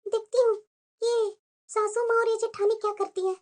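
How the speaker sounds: hushed, plotting to herself; quiet scheming aside. Acted. New bride plotting to herself, hushed scheming. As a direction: murmur the plan so the family can't hear.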